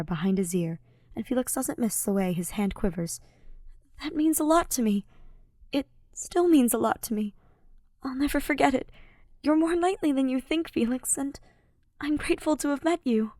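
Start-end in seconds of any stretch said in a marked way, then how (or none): 4.0-13.3 put-on higher, gentle young man's voice; Ash's earnest voice. A narrator's reading. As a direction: voice Ash pleading sincerely before battle. Ash speaks to Felix.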